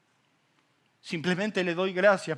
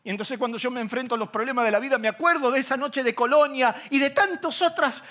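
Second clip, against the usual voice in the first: full-force preaching; 10 semitones higher, 9 dB louder than usual. Acted. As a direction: rouse the congregation. (predicación a toda voz)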